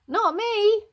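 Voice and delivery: very high loud denial; shouted. Acted. Kids denying the smell, shouted. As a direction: shout it quick. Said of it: "they all shouted".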